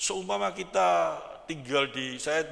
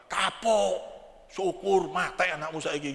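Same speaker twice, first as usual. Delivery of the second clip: mock gloating in Javanese, a little higher; gloating taunt; 4 semitones higher than usual. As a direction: sneer at the neighbour whose child died. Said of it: "gitu apa?". (ejekan puas, sedikit lebih tinggi)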